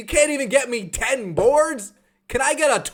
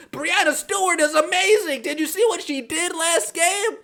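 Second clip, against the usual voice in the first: mock fan gushing; 8 semitones higher than usual. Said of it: "everybody was telling me".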